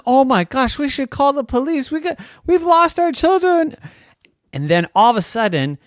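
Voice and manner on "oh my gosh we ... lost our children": high, loud rising alarm; panicked parent. Acted. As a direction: spiral into acted parental panic. Parents panic over lost children.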